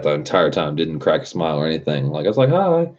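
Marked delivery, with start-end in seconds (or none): 2.5-2.9 much higher, sing-song mock hello; fake cheery hi. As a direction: chirp a fake-friendly hi. Greeting the pouty girl.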